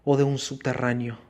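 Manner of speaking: plain reading voice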